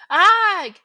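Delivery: high, loud yelp of burning pain; a sudden scorched yelp; pained cry. Acted. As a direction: cry of a man burned by touch. Quirrell's face burned.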